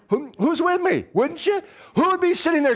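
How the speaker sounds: high, loud eager call-out; rally the crowd, way up; excited rallying. Real, not acted. Pastor excitedly calling on the congregation.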